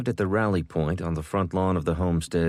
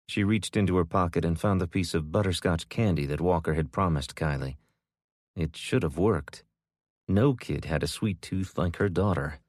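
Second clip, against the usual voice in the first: usual speaking voice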